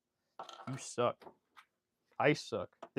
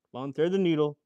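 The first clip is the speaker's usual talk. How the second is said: louder, pleading with the needle; pleading with a machine; 4 semitones higher, 8 dB louder than usual. Real, not acted. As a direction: beg the needle to cooperate.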